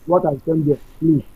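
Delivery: normal talking voice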